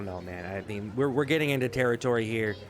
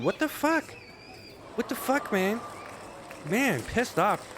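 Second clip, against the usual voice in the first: much higher, disbelieving what the fuck; ranting at the video, incredulous and high; exasperated; 8 semitones higher, slower than usual. Real, not acted. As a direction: yelp it in disbelief. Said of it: "exasperated outburst".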